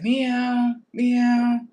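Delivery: high, drawn-out meow; cat meowing. Retold or imitated. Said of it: "I hear this cat".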